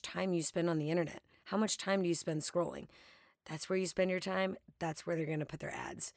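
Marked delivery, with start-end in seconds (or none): none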